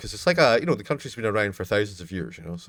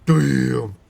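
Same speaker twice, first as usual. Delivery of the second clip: ordinary talk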